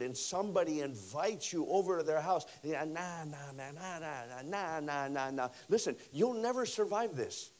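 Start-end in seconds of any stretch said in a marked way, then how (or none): 2.8-5.5 lazy refusal. Retold or imitated. New convert refusing invitations.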